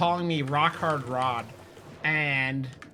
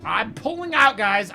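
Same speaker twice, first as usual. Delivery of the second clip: excited announcement; 5 semitones higher, 8 dB louder, slower than usual. Real, not acted. Streamer announces leaving loudly.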